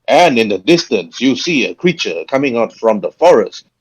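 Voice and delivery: ordinary delivery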